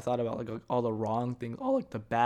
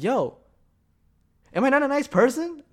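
much higher, louder offended question; indignant disbelief; 13 semitones higher, 8 dB louder than usual. Retold or imitated. Storyteller replaying his hurt reaction to friends, loud and high. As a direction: sound outraged, half joking. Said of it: "And I was like".

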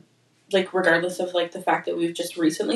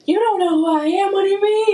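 flustered; 12 semitones higher, 3 dB louder than usual. Retold or imitated. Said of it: "makes me feel uncomfortable sometimes 'cause I'm like".

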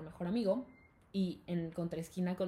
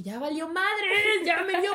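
raised, louder mock panic; higher-pitched "we're doomed" reaction; alarmed retelling; 9 semitones higher, 7 dB louder, slower than usual. Student realizing the teacher saw her, mock panic.

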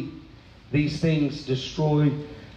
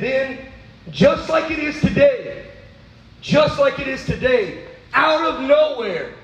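high, rising preacher's cry; preaching at full force; 6 semitones higher than usual. Acted. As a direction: build the sermon to a fever pitch. Preaching build to high pitch.

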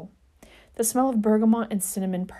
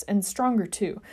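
plain reading voice

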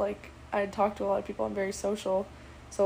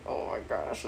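breathless whisper; 4 dB quieter than usual. Retold or imitated. Overwhelmed at his compliment.

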